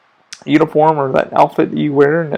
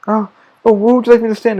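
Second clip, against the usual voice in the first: eager guest; 7 semitones higher, 3 dB louder than usual. Retold or imitated. Polite guest asking where to stand.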